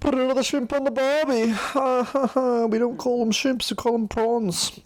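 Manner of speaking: very high, exaggerated Australian accent. Host shows the others an Australian accent, high and hammy. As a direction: ham up a stock Aussie accent. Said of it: "I was trying to say it in an Australian accent".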